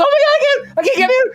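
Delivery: very high squeaky mock panic; panicked Angela. Angela can't hold the animal, a high mock squeal. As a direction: squeal it like she's overwhelmed. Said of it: "she's like".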